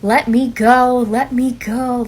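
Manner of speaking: put-on loud, pleading cry of a scared toddler; child's yell. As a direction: yell it like a child being held back. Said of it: "He starts yelling".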